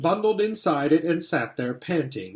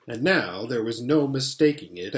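ordinary delivery, nothing marked

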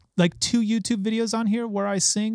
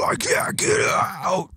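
high breathy scream, mostly air; 11 semitones higher, 3 dB quieter, slower than usual. Shown as a demonstration. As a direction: scream the line as hard as you can. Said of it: "just scream it as loud as you can".

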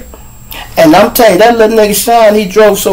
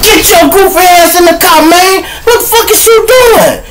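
angry shout. Retold or imitated. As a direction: yell at him to get in the car. Retold yelling at friend.

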